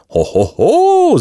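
slow, high "ho, ho, ho" whoop; hammed-up high cheer; maidens' outcry. A narrator's reading. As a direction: whoop in drawn-out admiration. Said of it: "zakrzyknęły dziewice".

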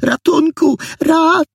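very high, shouted call for help; desperate cry. A narrator's reading. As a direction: scream for help, cut off short. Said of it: "Zaczęła wołać rozpaczliwie". (rozpaczliwe wołanie o pomoc)

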